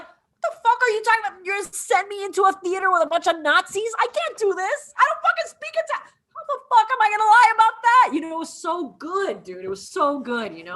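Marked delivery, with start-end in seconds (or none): 0.0-8.1 high-pitched acted panic, building louder; incredulous outburst. Retelling the recruit sent among Nazis without Italian, incredulous outburst. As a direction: protest in mock disbelief, climbing higher. Acts out actor's panicked objection.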